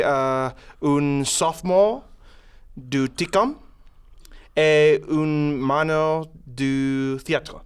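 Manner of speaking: hammy schoolboy French with hesitations; mock French. Asked for an accent, student introduces himself in mock French. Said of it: "do any sort of accent, I'd like to hear it".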